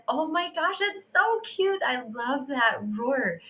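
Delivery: high sweet cooing voice. Retold or imitated. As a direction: gush over a cute child. Cooing at daughter's roar.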